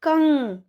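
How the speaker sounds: कंग with the ng drawn through the nose; nasal ang sound. Shown as a demonstration. Teacher sounding out कंग for children. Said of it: "तो कैसे पढ़ेंगे?".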